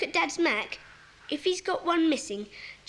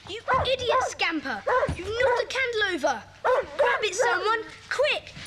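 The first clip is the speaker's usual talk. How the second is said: high, louder cries of panic; alarmed shout; 6 semitones higher, 3 dB louder than usual. Acted. Child scolding the dog as the candle falls, alarmed shout. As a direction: yell out urgent orders.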